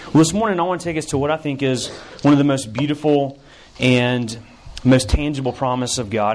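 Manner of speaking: ordinary talk, nothing marked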